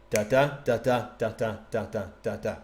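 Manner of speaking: "da da" in even pairs, weak then strong; iambic rhythm. Shown as a demonstration. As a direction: tap out "da DA" like a metronome. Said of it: "an unstressed syllable followed by a stressed".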